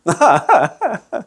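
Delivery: high, bouncing chuckle; laughing at his own imitation; amused laugh. Real, not acted. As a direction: burst into a short chuckle. Laughs at his own mock plea.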